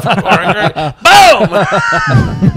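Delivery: high reply bursting into a shouted boom; giving-in gunshot reenactment. Retold or imitated. Nephew talked into firing uncle's gun, shouted boom. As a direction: give in, then blast it out.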